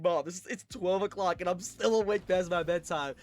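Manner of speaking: put-on high, loud kid excitement; imitating a thrilled little rebel; boastful young rebel. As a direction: brag to mom about breaking the rules. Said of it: "feeling like such a rebel".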